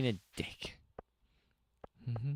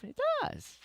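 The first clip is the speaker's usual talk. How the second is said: high character voice; 6 dB quieter than usual. Pretend. Voices NPC reply.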